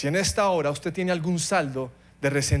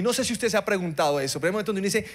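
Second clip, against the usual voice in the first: ordinary talk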